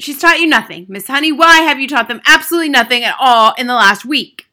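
high, loud accusation; gloating, overbearing headmistress; triumphant cry. A narrator's reading. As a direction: crow it as an indictment. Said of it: "cried the Trunchbull, triumphant".

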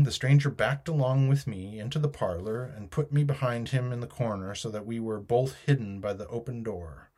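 ordinary delivery, nothing marked

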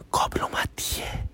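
soft, breathless ghostly whisper; eerie whisper. Retold or imitated. The mystery caller whispering to the husband, eerie and soft. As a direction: hush it like something from beyond. Creepy voice on the phone.

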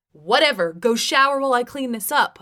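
loud, angry order; uncontrolled rage. A narrator's reading. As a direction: snap it out with open rage. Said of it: "without controlling my rage at all".

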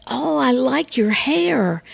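high, sweet-sounding praise; pleased compliment to a girl; friendly compliment. Shown as a demonstration. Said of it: "say the exact same words in so many different ways".

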